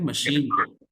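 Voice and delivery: normal talking voice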